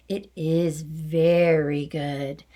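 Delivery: slow, drawn-out, oily voice; slow sly agreement. Acted. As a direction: savour each word slyly. Trunchbull mock-sweet agreement.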